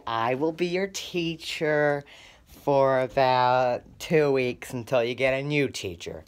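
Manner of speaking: ordinary talk, nothing marked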